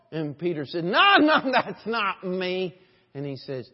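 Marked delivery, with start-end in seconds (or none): 0.9-2.7 high, loud mock protest; hammed-up denial. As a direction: protest loudly, half laughing. Peter's panicked denial acted out.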